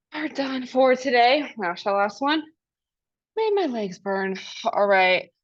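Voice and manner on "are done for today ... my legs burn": ordinary talk, nothing marked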